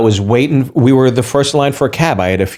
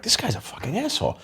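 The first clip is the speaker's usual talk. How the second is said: much softer, under the breath; muttered thought; 5 semitones higher, 13 dB quieter than usual. Retold or imitated. Waiting on a rude cabbie, muttered under the breath. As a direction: grumble it to yourself. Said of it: "I'm like".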